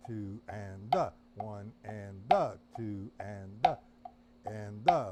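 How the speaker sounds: counting one and da with a punched da; accent on da. Shown as a demonstration. Teacher counting aloud with the accent on da. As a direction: stress the third syllable, da. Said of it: "play the Stone Killer the way he presents it".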